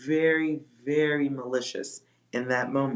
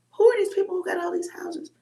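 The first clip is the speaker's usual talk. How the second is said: baffled squeak; 3 dB louder, faster than usual. Retold or imitated. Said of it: "And I'm like".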